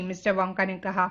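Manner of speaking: ordinary delivery, nothing marked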